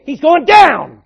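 loud, high triumphant boast; confident boxer shout. Retold or imitated. Preacher showing how a boxer boasts, loud confident shout. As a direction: shout it like a fighter sure to win. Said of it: "You go in saying".

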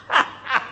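contemptuous laugh. Shown as a demonstration. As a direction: laugh at them under your breath. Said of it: "You know what Jesus says?".

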